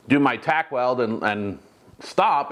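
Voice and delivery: ordinary talk, nothing marked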